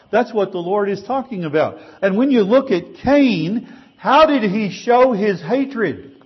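rising preacher's fervor; forceful preaching. Acted. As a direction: drive the point home from the pulpit. Preaching emphasis.

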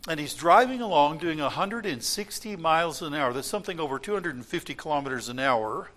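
ordinary talk, nothing marked

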